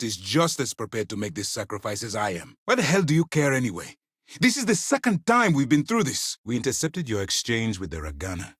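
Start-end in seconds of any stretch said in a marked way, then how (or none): 2.6-6.4 high outburst, out of patience; exasperated anger. Acted. Commander going through this a second time, angrily exasperated. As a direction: snap back, pitch climbing.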